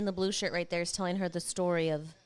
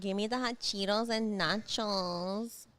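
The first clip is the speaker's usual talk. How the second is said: slow, lightly put-on San Antonio accent; a hint of San Antonio accent; slower than usual. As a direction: play up a San Antonio drawl. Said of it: "That's just San Antonio".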